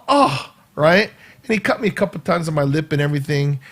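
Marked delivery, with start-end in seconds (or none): 0.1-0.5 pained cry. Retold or imitated. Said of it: "I'm going".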